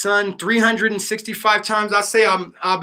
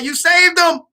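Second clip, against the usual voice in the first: high, loud retold cry of amazement; grateful exclamation; 11 semitones higher, 4 dB louder than usual. Rescued kid's parents hugging the young rescuer, imitated excited outburst.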